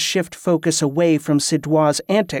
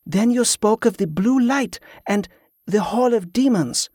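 higher-pitched wife's voice, explaining; earnest wife; 6 semitones higher than usual. A narrator's reading. Wife continues her account.

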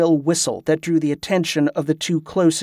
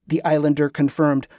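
ordinary delivery, nothing marked

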